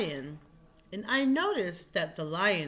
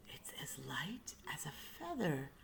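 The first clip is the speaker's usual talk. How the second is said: whisper, much softer; whispered wonder; 3 semitones lower, 12 dB quieter than usual. Acted. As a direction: breathe it out in awe. Lion marvels softly.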